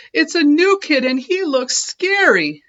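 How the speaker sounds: high, loud alarm; alarmed cry. A narrator's reading. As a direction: warn the others in fright. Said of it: "cried Joey Mackerel".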